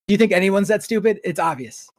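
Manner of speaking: ordinary talk, nothing marked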